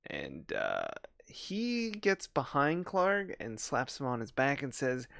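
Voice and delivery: usual speaking voice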